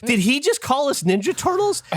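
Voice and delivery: high, anxious voicing of his wife; worried wife. Wife asking if they were mocked, high worried voice. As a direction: sound hurt and unsure. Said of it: "And she goes".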